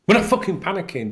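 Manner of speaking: high, exasperated protest; real frustrated outburst; angry outburst. Real, not acted. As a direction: snap back at the accusation. Angry rant about journalists.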